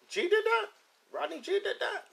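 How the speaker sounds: high-pitched incredulous question; imitated disbelief. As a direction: squeak it like an astonished fan. Voicing surprised viewers of his work.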